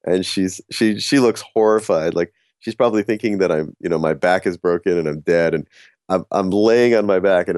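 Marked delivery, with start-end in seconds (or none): none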